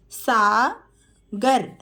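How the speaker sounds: Sagar stretched very slowly; slow teacher model of Sagar. Shown as a demonstration. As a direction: draw Sagar out sound by sound. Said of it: "teacher models name slowly".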